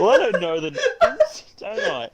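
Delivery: high, helpless laughter through the words; real laughing protest. Real, not acted. Owning up to not knowing the names, laughing protest. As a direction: protest while cracking up.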